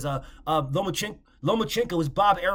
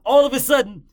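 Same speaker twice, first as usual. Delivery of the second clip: loud mocking burst; 7 semitones higher, 11 dB louder than usual. Real, not acted. Mocking the flip-flop, laughing.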